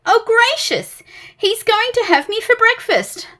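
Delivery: high, loud, fussy terror; a nervous little creature wailing in alarm; panicky Mister Jelly. Acted.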